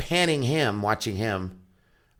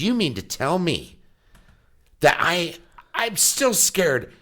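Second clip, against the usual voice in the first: high, emphatic disbelief, imitating him; mock indignation; 8 semitones higher, 4 dB louder, slower than usual. Grown son mad that he's still scared, mock indignant. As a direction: act out an affronted son, stretching the end. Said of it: "then he gets mad".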